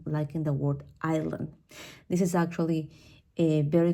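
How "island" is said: island with no S sound; silent S. Shown as a demonstration. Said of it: "the S can also be silent".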